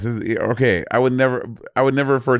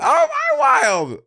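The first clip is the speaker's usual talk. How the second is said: very high, drawn-out protest; indignant squeal; much slower than usual. Real, not acted. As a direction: squeal it in disbelief at the accusation. Protesting an accusation.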